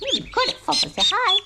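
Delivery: high-pitched cheering at ducklings; excited pet talk. Real, not acted. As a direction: praise the ducks like a proud parent. Praising ducks.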